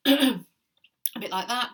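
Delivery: slow, rough chesty voice. Real, not acted. Vlogger showing viewers her chesty voice, rough and slow. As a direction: let the cough-roughened voice show. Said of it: "If you can hear in my voice".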